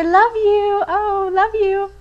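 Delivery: casual love you. Retold or imitated. American girl ending a phone call, breezy. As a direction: chirp love you like it's nothing. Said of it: "we say".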